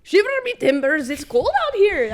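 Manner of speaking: high, loud pirate accent, full out; thick pirate accent. Shown as a demonstration. Asked for a thick accent, she hams up a pirate. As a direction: go all in on a hammy pirate voice. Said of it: "A thick accent".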